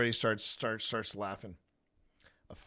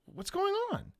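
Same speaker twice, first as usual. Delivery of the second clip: put-on baffled kid. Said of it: "and he goes".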